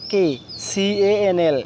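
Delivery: normal talking voice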